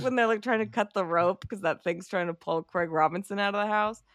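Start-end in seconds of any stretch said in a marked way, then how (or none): none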